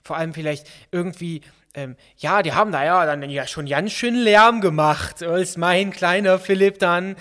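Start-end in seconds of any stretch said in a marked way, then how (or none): 2.2-7.2 louder, drawn-out gossiping mother; lightly voiced mother. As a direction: play a mother telling all, slowly and loudly. (leicht gespielte plaudernde Mutter)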